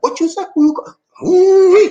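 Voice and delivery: high, laughing indignant yelp; hammed-up outrage; mock outrage. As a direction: fake fury dissolving into laughter.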